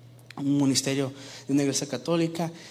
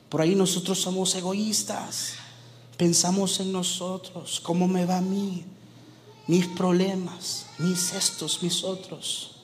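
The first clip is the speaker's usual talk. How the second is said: raised pitch, rebuking the congregation's self-pity; self-centred complaint; 5 semitones higher than usual. Acted. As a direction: hammer out each selfish grievance. Preacher mimicking selfish churchgoers.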